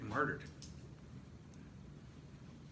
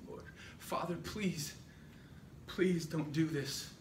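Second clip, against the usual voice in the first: higher, acted plea; pleading through tears; 4 semitones higher, slower than usual. Isaac looking to his father in fear, tearfully pleading. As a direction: beg as a frightened son, lightly acted. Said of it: "he's crying and he's saying".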